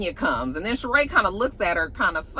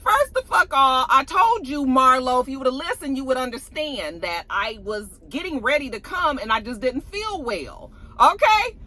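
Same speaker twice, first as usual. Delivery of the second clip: angry; 4 semitones higher than usual. Retold or imitated. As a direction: snap back in anger. Retelling Kenya's angry reply.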